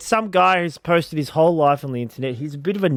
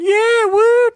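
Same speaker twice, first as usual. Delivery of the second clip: high, loud cheer from a crowd; imitated fan cheer; crowd shouting; 7 dB louder than usual. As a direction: whoop like an excited onlooker. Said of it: "shouting out to him".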